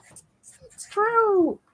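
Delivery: very high, drawn-out squeak; playful falsetto. Pretend. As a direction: echo it in a silly falsetto. Jokingly repeats it in high voice.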